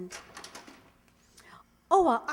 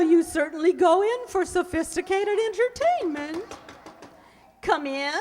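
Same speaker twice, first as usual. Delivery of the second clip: ordinary delivery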